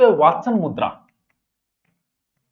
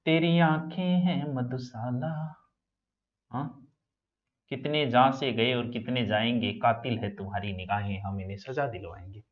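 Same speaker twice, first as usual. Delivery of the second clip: romantic shayari; 6 semitones lower, 10 dB quieter than usual. Pretend. Teacher joking about eyes, soft romantic recital. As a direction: croon the couplet to a lover.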